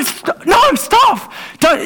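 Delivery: high sharp cry to stop; a parent crying out as a child pokes a power point; alarmed yell. Shown as a demonstration. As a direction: shout a child away from danger. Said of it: "You go".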